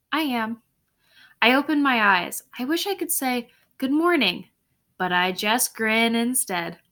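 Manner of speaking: usual speaking voice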